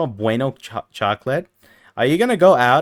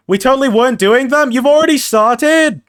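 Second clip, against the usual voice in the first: indignant high outburst; 11 semitones higher, 5 dB louder than usual. Real, not acted.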